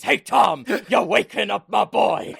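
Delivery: a hint of a folksy stump speech; Bernie Sanders voice. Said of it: "my Bernie Sanders is".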